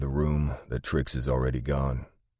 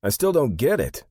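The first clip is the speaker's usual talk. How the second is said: high, loud whine; puzzled, high-pitched complaint; whining; 12 semitones higher, 8 dB louder than usual. A narrator's reading. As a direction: whine it in confusion. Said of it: "Sandy whines".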